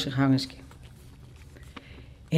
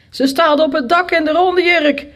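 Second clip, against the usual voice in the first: put-on high, raised butcher's-boy call; calling across the street; 10 semitones higher, 4 dB louder than usual. A narrator's reading. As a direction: call the news over to the men opposite. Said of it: "riep de slagersknecht tegen mannen aan de overkant". (hoog over straat roepend)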